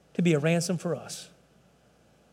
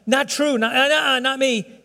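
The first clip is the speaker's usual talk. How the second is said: raised, rapid-fire protest; higher pleading no; insistent denial; 6 semitones higher, slower than usual. Retold or imitated. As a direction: protest over and over like Peter. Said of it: "He kept incessantly saying".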